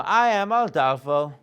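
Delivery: slow, loud proclamation; demonstrated stage line. Shown as a demonstration. Coach demonstrating the Adolfo introduction, slow and loud. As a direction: announce yourself grandly, word by word. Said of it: "So it could be that".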